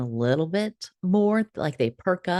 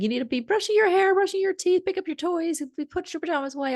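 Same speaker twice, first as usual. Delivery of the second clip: grandma nagging; 13 semitones higher, faster than usual. Retold or imitated. Grandma scolding little Cindy, high nagging voice. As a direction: rattle it off like a fed-up mum. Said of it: "and she said".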